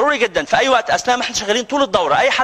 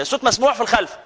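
ordinary talk, nothing marked

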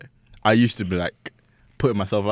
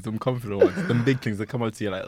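ordinary talk

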